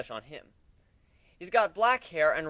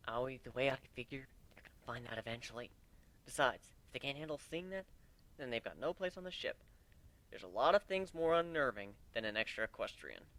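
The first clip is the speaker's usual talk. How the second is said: soft, hushed aside; huddled quiet talk; 3 semitones lower, 9 dB quieter than usual. Acted. Narrator huddling with Aoi, speaking softly. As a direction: keep it low so the crew can't hear.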